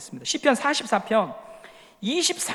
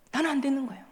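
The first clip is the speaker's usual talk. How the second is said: much softer, falling away; quiet repeat; 8 dB quieter, faster than usual. Shown as a demonstration. Preacher repeats the believer's lament much softer. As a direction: let it drop off, resigned.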